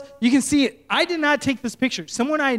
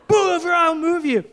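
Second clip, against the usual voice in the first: higher, louder threat; angry shout; 7 semitones higher, 3 dB louder than usual. Retold or imitated. Angry driver threatening the pastor, loud threat. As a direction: yell the threat again. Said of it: "he's just not reasonable".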